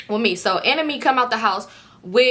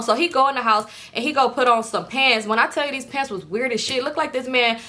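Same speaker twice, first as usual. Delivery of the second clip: ordinary delivery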